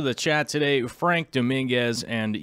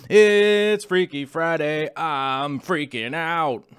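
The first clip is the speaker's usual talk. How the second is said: hammed-up, slow, stretched-out cry; mock freak-out; 4 semitones higher, 5 dB louder, much slower than usual. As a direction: pretend to freak out, dragging the words. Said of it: "I'm not really freaking out".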